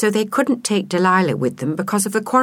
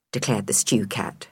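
usual speaking voice

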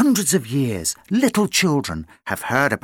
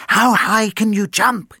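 dog voice; 7 semitones higher than usual. Acted. Billy speaks.

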